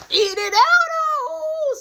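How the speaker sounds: high, loud whoop; the son cheering when mom backs him, high and loud; hype cheer. Retold or imitated. Celebrating mom's support.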